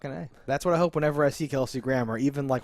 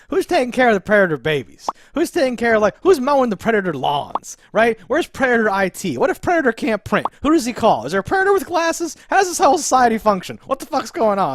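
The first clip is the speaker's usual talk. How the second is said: louder and higher, a string of mock-outraged questions; ranting; 9 semitones higher, 6 dB louder than usual. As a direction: rant it out like an exasperated fan. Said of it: "went on this rant of".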